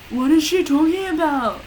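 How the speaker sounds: put-on slurring drunkenly; slurred. A narrator's reading. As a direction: slur the words. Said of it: "Lupin slurred".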